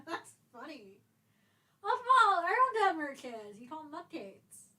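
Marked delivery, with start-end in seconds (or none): 1.8-3.6 excited. Real, not acted. Excited outburst.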